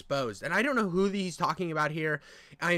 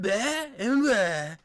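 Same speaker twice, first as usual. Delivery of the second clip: Trump impression; 3 semitones higher, much slower than usual. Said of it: "I have a really nasally voice".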